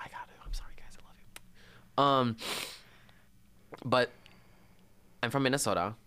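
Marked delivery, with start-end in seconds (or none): 0.0-2.0 hushed sorry to listening friends; whispered apology. Real, not acted. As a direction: breathe the apology.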